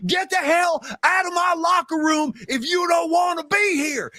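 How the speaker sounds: high-pitched imitation of a Texas coach yelling; Coach Taylor. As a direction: holler at the team in a put-on Texas accent. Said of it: "He's yelling at the team".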